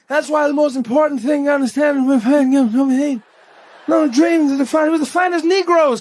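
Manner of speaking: high, slurred speechifying into mumbles; Joe Biden. Acted. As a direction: run the words together into mush. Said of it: "fades into mumbles every time he gives a speech".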